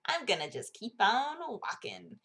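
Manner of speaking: drawn-out mock film director voice; Guillermo del Toro. Director brushing off an annoying interviewer, slow impression. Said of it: "that's what Guillermo del Toro sounds like".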